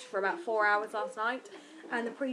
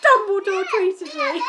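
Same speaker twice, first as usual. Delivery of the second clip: much higher and louder, slow gleeful cheer; triumphant cheer; 10 semitones higher, 11 dB louder, slower than usual. Retold or imitated. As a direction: gloat over the big news. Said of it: "I was like".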